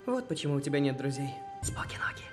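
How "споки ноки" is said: whisper. Acted. Whispered goodbye.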